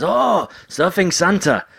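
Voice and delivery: high, slow mock delight; drawn out like a thrilled fan. Japanese person answering comedian.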